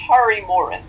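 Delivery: Kari Morin, clear and deliberate, "Kari" like "car-ee"; name said correctly. Shown as a demonstration. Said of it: "No one ever gets it right".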